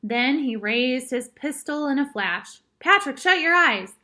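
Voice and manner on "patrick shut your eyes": higher, louder cry of warning; urgent warning. A narrator's reading. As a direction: call it out urgently, high and loud. Urgent warning as pistol is raised.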